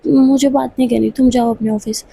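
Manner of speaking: ordinary delivery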